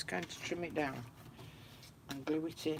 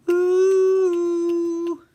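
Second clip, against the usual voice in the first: high excited ooh; 13 semitones higher, 16 dB louder than usual. Real, not acted. Sudden exclaim.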